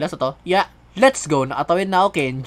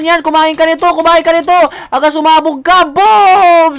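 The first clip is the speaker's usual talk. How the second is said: excited shouting; 6 semitones higher, 6 dB louder than usual. Real, not acted. Boss fight in the game.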